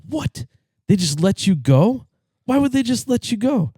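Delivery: high, squeaky disbelief; shocked disbelief. Acted. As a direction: voice jumps up in shock. Man shocked by alien's answer.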